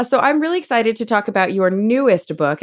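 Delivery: normal talking voice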